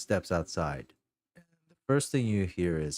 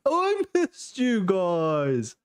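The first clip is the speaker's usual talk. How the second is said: much higher, slow, gushing cry; overjoyed reunion; 12 semitones higher, 3 dB louder, slower than usual. Acted. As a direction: pour out the relief of finding them. Albert hugging friends.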